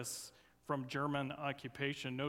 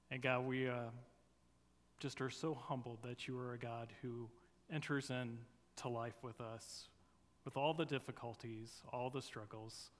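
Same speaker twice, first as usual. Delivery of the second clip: softer, hushed prayer voice; opening prayer; 3 semitones lower, 5 dB quieter than usual. Acted. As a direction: pray it quietly and humbly. Opening prayer before the sermon.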